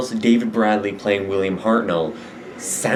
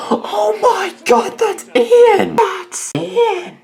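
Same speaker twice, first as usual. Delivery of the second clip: much higher, louder delighted shriek; thrilled squeal; 7 dB louder, much slower than usual. Real, not acted. Fan spotting the actor on screen, shrieking with delight.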